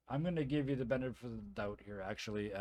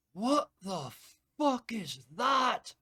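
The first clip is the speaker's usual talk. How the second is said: high, slow, shocked Scottish yell; a stunned Scotsman shouting; Scottish brogue, outraged; 10 semitones higher, 8 dB louder, much slower than usual. Acted. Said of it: "thick Scottish accent".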